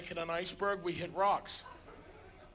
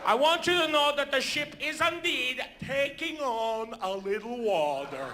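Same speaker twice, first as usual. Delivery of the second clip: put-on Norwegian accent; 3 semitones higher than usual. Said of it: "a Norwegian gentleman".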